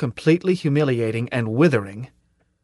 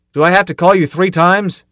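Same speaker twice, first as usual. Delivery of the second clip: higher, louder irritated demand; angry shout; 6 semitones higher, 7 dB louder than usual. A narrator's reading. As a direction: snap at the late orderly. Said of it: "was already shouting".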